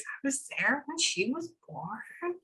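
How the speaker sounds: soft, slow, lifted woman's voice; imitating her voice. Medium lets the woman at the birth speak, soft slow imitation. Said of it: "I just hear her voice".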